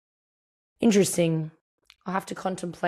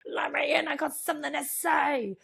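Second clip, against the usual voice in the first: a playful put-on dad voice pleading to be let in; dad's spirit demanding entry; 9 semitones higher, 3 dB quieter than usual. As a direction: squeak it out like a ghost banging on a door. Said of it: "being like".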